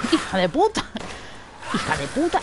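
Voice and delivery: real jolted swearing; startled angry curse. Real, not acted. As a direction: spit it out in fright. Cursing right after the scare.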